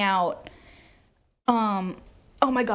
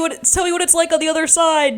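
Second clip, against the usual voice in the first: shouting; 6 semitones higher, 5 dB louder than usual. Pretend. Acted quote shouting at a ghost.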